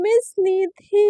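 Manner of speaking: drawn-out, sweetly teasing "मिस Nidhi"; cute smitten tone. Retold or imitated. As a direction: coo her name slowly, flirting. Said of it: "cute सा smile देके ना बोलती है".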